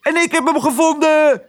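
heel hoge, uitgelaten vogelkreet; schreeuwend musje Toep. A narrator's reading. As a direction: schreeuw het uit met een piepstem. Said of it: "schreeuwde Toep". (hoog schreeuwend musje)

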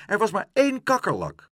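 high-pitched, loud protest; indignant. A narrator's reading. Pluk answering the porter's complaint, indignant. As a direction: insist on it, wronged. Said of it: "riep Pluk verontwaardigd".

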